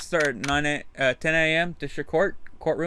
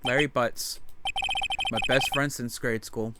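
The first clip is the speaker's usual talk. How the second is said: story narration; 7 dB quieter than usual. Acted. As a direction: tell it quietly, like a case file. Reads Phoenix's inner narration.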